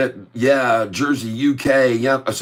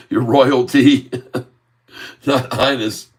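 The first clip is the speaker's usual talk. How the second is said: laughing; slower than usual. Real, not acted. Correcting the title, laughing.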